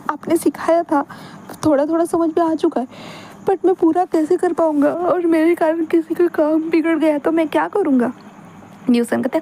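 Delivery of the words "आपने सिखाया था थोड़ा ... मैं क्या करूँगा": put-on anxious young man. Wing frets to his friend about failing at work, lightly voiced and higher.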